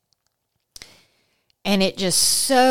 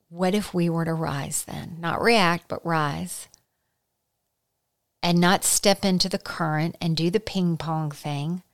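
ordinary talk

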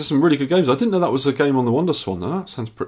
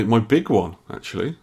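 ordinary talk, nothing marked

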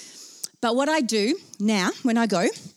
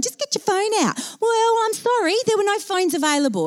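high, sassy comeback; mock indignation; 10 semitones higher than usual. Storyteller to listeners who'd suggest a phone, high-pitched mock indignation. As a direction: answer the doubters in high mock apology.

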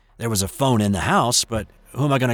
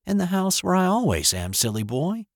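higher, lightly teasing mother's voice; amused mom; 8 semitones higher than usual. Retold or imitated. Returned mom answering her son, lightly amused. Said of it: "She laughed and said".

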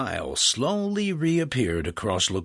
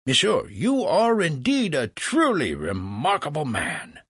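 higher, swooping admiration; flattering Franklin; 6 semitones higher than usual. Acted. Franklin praises Legras as remarkable, theatrically admiring. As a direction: heap on staged wonder.

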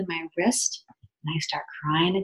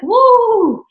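sudden high yelp of surprise, loud; startled woo; 11 dB louder than usual. Retold or imitated. Retelling the first needle going in, a loud high yelp. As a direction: let out a shocked high whoop. Needle stuck in her butt.

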